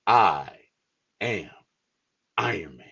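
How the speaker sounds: low hushed delivery with long pauses; solemn movie quote. Retold or imitated. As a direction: pause between each word like a final line. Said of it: "And he's like".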